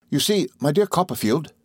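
ordinary delivery, nothing marked

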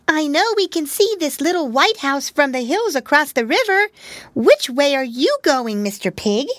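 put-on high, small girlish voice; a tiny pig asking eagerly; little pig voice. Pigwig asks Pigling his way.

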